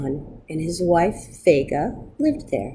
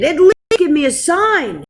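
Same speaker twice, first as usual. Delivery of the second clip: higher, louder desperate plea; pleading; 10 semitones higher, 5 dB louder than usual. A narrator's reading. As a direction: beg him for any sign. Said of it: "Gershon pleaded".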